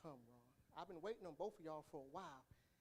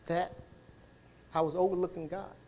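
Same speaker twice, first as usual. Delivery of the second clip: loud, driving sermon voice, louder; preaching at full force; 20 dB louder than usual. Acted. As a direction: preach it out to the church, full volume.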